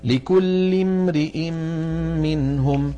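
slow recitation, "امرئ" with "i" after the r; ra follows next vowel. Shown as a demonstration. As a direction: chant "امرئ" long and slow as "imri-in". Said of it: "تتبع الراء حركة ما بعدها". (تلاوة «امرئ» ببطء بكسر الراء)

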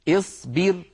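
اصبر said as isbir, slow, with kasra; initial kasra. Shown as a demonstration. Teacher gives the example اصبر with kasra, slow. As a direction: start اصبر with a kasra, slowly. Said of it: "لاحظوا الباء كيف أنها مكسورة".

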